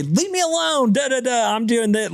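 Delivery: high, whiny mock brush-off; a hint of a grumpy toddler; mock annoyed kid. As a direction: play a cranky little kid. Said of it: "She didn't say".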